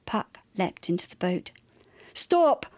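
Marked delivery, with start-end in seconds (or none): 2.1-2.7 high, urgent shout; sharp raised cry. A narrator's reading. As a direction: shout to halt someone. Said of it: "shouted Cassius".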